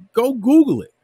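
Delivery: high loud go Google it; loud scoffing dare; loud retort. Real, not acted. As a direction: shout the challenge. Mocking retort to doubters.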